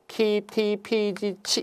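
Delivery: 키, 티, 피, 지, 치 as open syllables, softly listed; old letter names. Shown as a demonstration. Teacher showing how these letters were once read, 키, 티, 피 ending on open "ee". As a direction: read 키, 티, 피 softly with no final consonant. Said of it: "이렇게 읽었어요".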